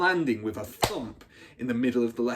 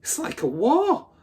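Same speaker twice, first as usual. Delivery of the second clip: overawed; 13 semitones higher, 6 dB louder than usual. A narrator's reading.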